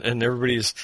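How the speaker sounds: ordinary talk, nothing marked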